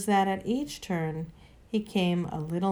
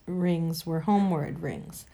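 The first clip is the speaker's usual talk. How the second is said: usual speaking voice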